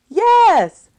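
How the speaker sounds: high, loud chorus of yes; children keen to go out; eager children's yes. A narrator's reading. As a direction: shout it eagerly. Children answer teacher.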